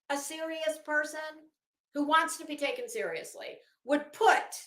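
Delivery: ordinary delivery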